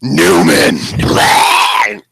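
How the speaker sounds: gruff mock shout with a spit; spitting shout. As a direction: bark the name, then spit. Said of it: "what the lizard said before".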